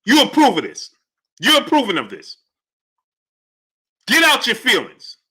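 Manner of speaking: high, exasperated challenge; indignant disbelief. Real, not acted. Host scolding chat for approving, indignant. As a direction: press them, appalled. Incredulous at chat's defence.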